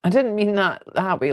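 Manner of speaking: normal talking voice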